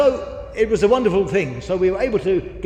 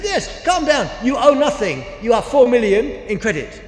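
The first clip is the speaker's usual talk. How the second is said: higher, louder, insistent reassurance; emphatic insistence; 5 semitones higher, 5 dB louder than usual. Retold or imitated. Manager insists to the worried reverend, mock loud. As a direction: press the good news home.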